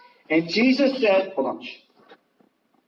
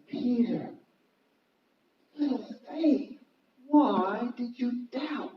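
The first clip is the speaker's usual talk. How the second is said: softer, higher, slow questioning; gentle reproach; 7 semitones higher, 5 dB quieter, slower than usual. Acted. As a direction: ask it tenderly, with pauses between phrases. Repeats Jesus's question more gently.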